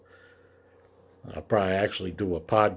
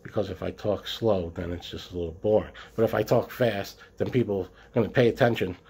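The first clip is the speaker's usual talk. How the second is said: ordinary delivery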